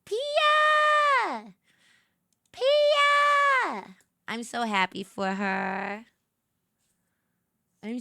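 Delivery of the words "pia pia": high, loud squeal of a name; excited shout. Real, not acted. Streamer cheering for her friend Pia, excited shout. Celebrating friend's new ambassador role.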